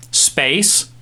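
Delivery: ordinary delivery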